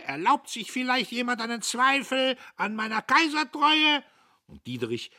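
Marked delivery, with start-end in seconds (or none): 0.0-4.0 much higher, loud crowing challenge. Acted. Jadassohn crows his loyalty at everyone: shrill crowing. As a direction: crow it out like a rooster. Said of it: "Jadassohn krähte".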